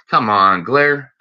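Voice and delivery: normal talking voice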